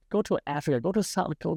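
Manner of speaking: usual speaking voice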